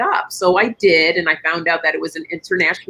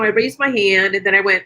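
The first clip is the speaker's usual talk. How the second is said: usual speaking voice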